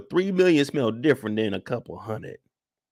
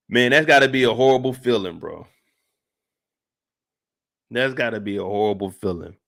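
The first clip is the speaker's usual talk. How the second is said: ordinary talk, nothing marked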